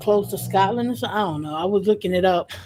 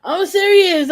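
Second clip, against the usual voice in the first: ordinary talk, nothing marked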